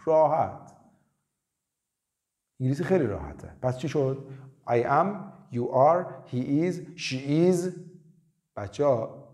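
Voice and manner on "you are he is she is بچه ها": normal talking voice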